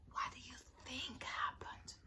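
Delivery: suspenseful storyteller's whisper; whispered question. A narrator's reading. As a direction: whisper it to draw the listeners in. Asks children in suspense.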